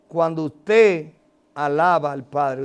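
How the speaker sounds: ordinary talk, nothing marked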